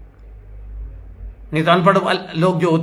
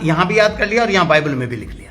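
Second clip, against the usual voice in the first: ordinary talk, nothing marked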